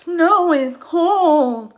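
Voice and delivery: high, loud, slow character voice; Feather's voice. Acted. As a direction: drag the line out as a shivering Feather. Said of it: "says Feather".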